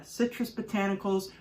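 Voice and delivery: ordinary delivery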